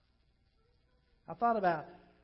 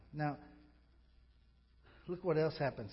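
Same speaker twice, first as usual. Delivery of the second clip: ordinary talk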